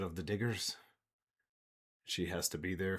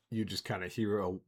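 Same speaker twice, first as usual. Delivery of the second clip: usual speaking voice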